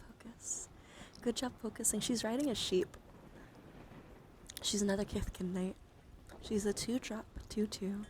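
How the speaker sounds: normal talking voice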